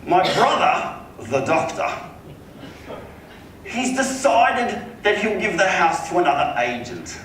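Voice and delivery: high, slow affected rich man's voice; Philip Harding, wealthy client. Retold or imitated. Homeowner breaks bad news to agent, high and drawn out. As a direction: imitate the client spelling out the news. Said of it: "he goes".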